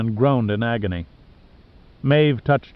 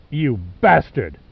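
snarling; slower than usual. A narrator's reading. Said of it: "Pitt snarled with animal hate".

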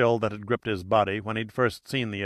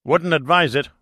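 curt retort; 4 semitones higher, 5 dB louder than usual. Acted. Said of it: "Austin shot back".

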